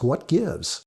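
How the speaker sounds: ordinary delivery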